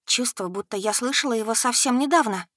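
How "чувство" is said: ordinary delivery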